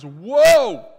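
loud, high cry of woe; anguished cry. Retold or imitated. Isaiah crying out before a righteous God in dread.

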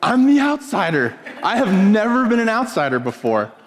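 high-pitched mock disbelief; hammed-up, high astonishment; incredulous realization. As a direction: exclaim it as a startling discovery. Retelling his shocked realization.

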